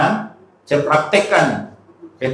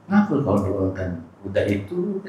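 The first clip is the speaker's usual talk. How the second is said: calm, not angry question; 5 semitones lower, 6 dB quieter than usual. Retold or imitated. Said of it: "tak ada kalimat marah".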